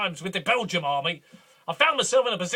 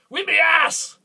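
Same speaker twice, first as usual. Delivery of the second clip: bragging shout; 7 semitones higher, 5 dB louder, slower than usual. Acted.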